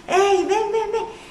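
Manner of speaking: high, excited call to come over, with a laugh; eager servant call. Acted. Servant calls out to the feast.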